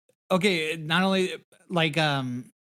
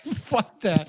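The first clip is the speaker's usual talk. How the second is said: mock refusal; 4 semitones higher than usual. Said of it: "He's like".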